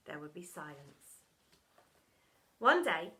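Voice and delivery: ordinary delivery, nothing marked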